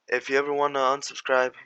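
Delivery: ordinary talk, nothing marked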